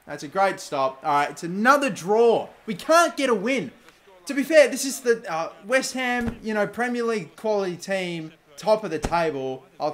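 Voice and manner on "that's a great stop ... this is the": ordinary talk, nothing marked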